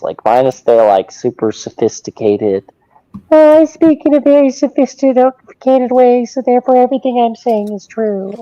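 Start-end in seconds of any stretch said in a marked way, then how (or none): 3.3-8.3 high, loud, snooty voice; mock pompous intellectual. Mocking sophisticated clerics, high and loud. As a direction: sneer it like a self-important know-it-all. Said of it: "just sound like the dumb Protestants".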